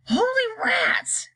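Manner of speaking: high, slow startled cry. Acted. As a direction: gasp it out in amazement. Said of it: "cried Shanks".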